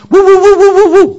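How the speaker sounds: very loud, high rapid woofs; dog barking. Shown as a demonstration. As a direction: bark back at the doorbell. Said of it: "The dog goes".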